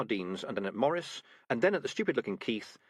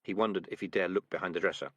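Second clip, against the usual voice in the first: usual speaking voice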